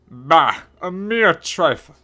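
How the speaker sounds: loud, dismissive scoff; pooh-pooh it in character. A narrator's reading. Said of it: "scoffed Gaston".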